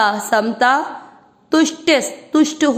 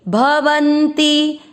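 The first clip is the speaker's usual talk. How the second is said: slow chanted verse, a little raised; Sanskrit recitation; 3 semitones higher, much slower than usual. Shown as a demonstration. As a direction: recite the half-line slowly for students. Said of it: "तीसरा चरण repeat".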